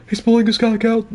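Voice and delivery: high, loud excited shriek; hype streamer quote. Retold or imitated. Quoting Big Moist's famous clip, high excited yell. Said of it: "except maybe the ... thing".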